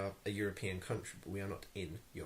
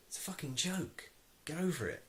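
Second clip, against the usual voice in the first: exasperated; 7 semitones higher than usual. Real, not acted. Scolding people offended by a joke.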